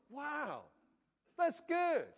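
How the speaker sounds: high, loud, beaming delight; smiling exclaim. Shown as a demonstration. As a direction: say it grinning from ear to ear. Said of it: "You have to smile to say it".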